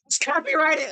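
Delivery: squeaky high mock alarm; mock shock. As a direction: gasp it out as a joke panic. Jokes the song is copyrighted.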